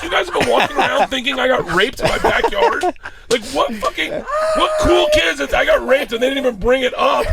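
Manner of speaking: high, shouted comic disbelief; hammed-up exasperated dad yelling; outraged rant. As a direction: rant at the top of your voice, half laughing. Acts out outraged rant at daughters.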